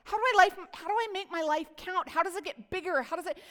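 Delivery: hammed-up desperate asking; pleading outburst. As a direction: voice the man's burning need to make life count. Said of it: "What he's really trying to say is".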